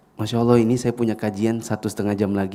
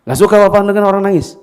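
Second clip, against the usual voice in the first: high, loud scolding bark; harsh scolding father; 8 semitones higher, 9 dB louder than usual. Acted. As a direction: snap at the crying child. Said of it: "Bukan begini".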